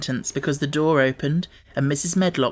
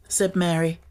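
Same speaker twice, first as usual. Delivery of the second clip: ordinary delivery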